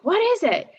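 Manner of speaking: sudden high startled question; alarmed mom. Retold or imitated. Mother rushing into the room asking, high and startled. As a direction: mom bursting in, worried. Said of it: "She's like".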